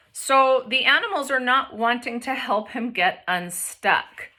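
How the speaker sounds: ordinary talk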